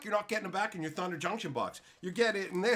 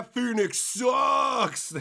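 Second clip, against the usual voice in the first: very slow, louder drawn-out shout; 2 semitones higher, 7 dB louder, much slower than usual. Real, not acted. Taunting the game store Phoenix Rising, slow shout. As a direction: bellow it slowly.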